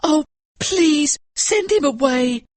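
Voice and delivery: high frantic plea; pleading child. A narrator's reading. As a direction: beg the master to send him away. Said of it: "cried the boy".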